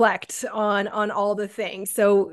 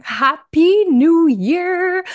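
high, slow, loud cheer; excited greeting; 8 semitones higher, 8 dB louder, much slower than usual. Real, not acted. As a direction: sing out the new year wish. New year greeting to listeners.

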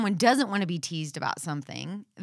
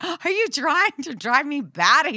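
exasperated; 5 semitones higher, 5 dB louder than usual. Retold or imitated. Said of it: "I'm like".

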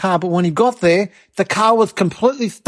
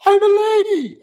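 mock haughty aunt; 12 semitones higher than usual. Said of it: "She's like".

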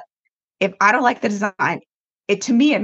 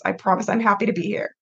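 ordinary talk, nothing marked

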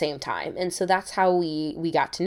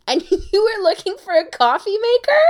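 laughing disbelief; 12 semitones higher, 4 dB louder than usual. Retold or imitated. Laughing at the mix-up.

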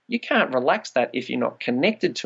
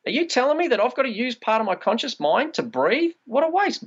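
higher, mock-incredulous question; incredulous challenge; 10 semitones higher than usual. Guest mocking a class instruction, higher and incredulous. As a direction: push back, amused and disbelieving. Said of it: "I put my hand up and say".

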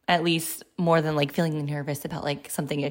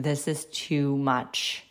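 weary inner thought; 5 semitones lower, 4 dB quieter, much slower than usual. Retold or imitated. Overwhelmed inner thought quoted.

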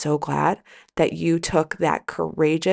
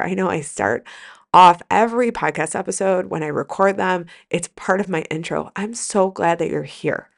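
usual speaking voice

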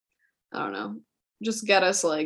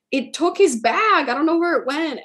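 retold alarmed reply; 8 semitones higher, 4 dB louder than usual. Narrator's panicked retold reply.

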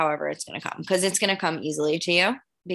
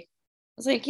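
normal talking voice

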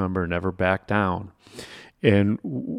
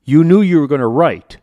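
louder, higher scolding boss; angry boss; 4 semitones higher, 8 dB louder than usual. Retold or imitated. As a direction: chew him out for forgetting the pen. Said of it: "And he goes".

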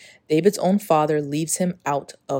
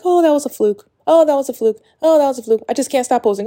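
high, louder brushing-off voice; self-dismissive quote; 9 semitones higher, 6 dB louder, faster than usual. Retold or imitated. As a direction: shrug it off as a fluke. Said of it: "it was just like".

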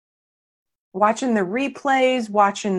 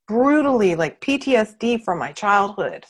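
ordinary talk

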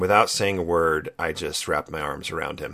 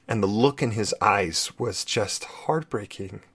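ordinary talk

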